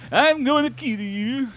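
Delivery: high, slow mock Nordic caveman accent; hammed-up Icelandic caveman accent. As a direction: growl a threat as a silly Icelandic caveman. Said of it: "Icelandic caveman".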